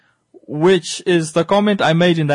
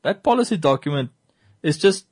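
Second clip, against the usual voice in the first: usual speaking voice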